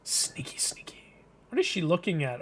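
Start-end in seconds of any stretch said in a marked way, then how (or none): 0.0-1.2 hushed, voiceless whisper; sneaky whisper. Pretend. Reader voicing characters sneaking about, sneaky whisper. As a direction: creep along in a whisper.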